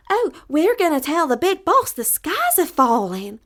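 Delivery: high, loud, breathless hen; alarmed little hen. Acted. Little hen telling the rooster the sky falls, high and loud. Birds announce the falling sky.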